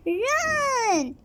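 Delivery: high urgent shout. A narrator's reading. Pieface shouting to his friends to flee. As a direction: yell it to get everyone moving. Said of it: "shouted Pieface".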